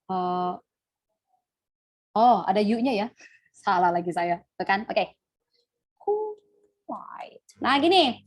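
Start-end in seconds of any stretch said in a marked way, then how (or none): 6.0-7.4 Quiet drawn out softly as ku-wait; sounding it out. Shown as a demonstration. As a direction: sound out Quiet in two slow pieces, ku, wait. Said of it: "itu spellingnya saya benar nggak".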